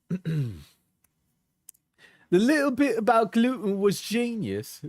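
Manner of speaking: mocking high girly voice. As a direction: read her text in a whiny mimic. Reads her messages in mock voice.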